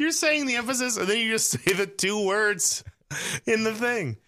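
high-pitched, worked-up objection; exasperated protest. Real, not acted. Bickering over how to say it.

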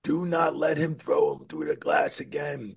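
high, urgent mock yell from the earpiece; retold frantic yelling. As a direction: push it up high, pleading them to stop. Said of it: "They were all screaming in my ear".

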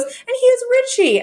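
very high mock announcing voice; doing Yetta. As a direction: sing out the name like a reveal. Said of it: "she goes".

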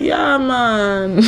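put-on a first, lightly tried Jamaican-style Rasta accent on Yeah, man; hint of a Rasta lilt, held back. Telling friends about a Rasta woman, a tentative Jamaican-style Rasta accent. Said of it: "without faking the accent".

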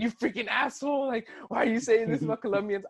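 high-pitched imitated angry message; mock outrage. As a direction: imitate an offended listener telling you off. Acts out angry future messages.

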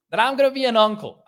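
bursting out the big news, high and loud; excited blurt of the news; excited reveal. Real, not acted. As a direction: announce it with a jump of joy.

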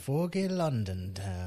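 soft, slow mock London "Foggy London town"; London accent. Co-host echoes the host in a quiet London accent. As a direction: answer dreamily in a light London accent. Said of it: "You sound like you're from London".

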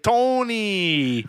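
playfully greeting the guest, in a mock New York accent. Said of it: "I should turn it back on".

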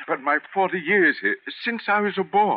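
begging to keep his job of forty years; desperate plea. Acted.

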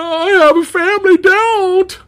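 very high, whiny begging squeal; hammed-up plea for mercy; mock-pleading guard. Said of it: "it was just like".

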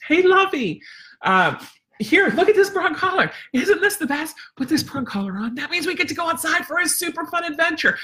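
high, laughing, gushing pitch; excited dog voice. Acted. Trainer hyping the prong collar to her dog, high and gushing. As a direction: sell the collar like a treat. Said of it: "So, you know".

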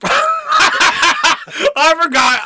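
laughing through the words; laughing as he remembers. Real, not acted. To his cohost, laughing as he remembers.

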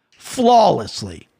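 slow, drawn-out, weighted word; drawn-out emphasis. Real, not acted. Vlogger grudgingly praising a rival's perfect spinning kick, slow and drawn out. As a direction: stretch it out with grudging awe.

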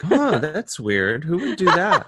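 slow, high mock-innocent wondering, breaking into a laugh; faux-puzzled innocent; playing dumb. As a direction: feign total innocence, drawn out. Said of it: "I was playing dumb".

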